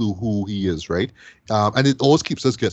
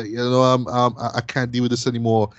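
ordinary talk

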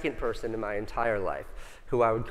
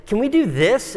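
put-on much higher and louder, like a kid asking; eager question; 10 semitones higher, 8 dB louder than usual. Asking his business partner, much higher and eager. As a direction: bounce it up with hope.

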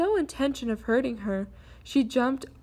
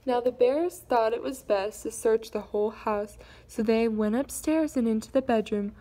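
ordinary delivery, nothing marked

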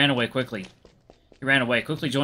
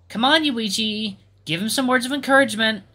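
coaxing friend; 10 semitones higher, 4 dB louder than usual. Acted. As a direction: lightly urge a friend on. Friend urging Yuichi to speak.

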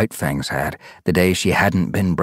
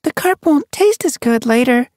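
put-on high, louder young she-cat; telling her; 4 dB louder than usual. A narrator's reading. Sun Paw presses her friend, high voice. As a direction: press her gently but firmly. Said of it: "Sun Paw told her".

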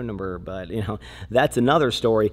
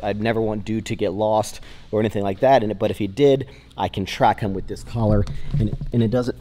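normal talking voice

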